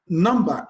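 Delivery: Number with a strong first syllable, NUM-ber; stress on first syllable. Shown as a demonstration. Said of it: "on the first syllable".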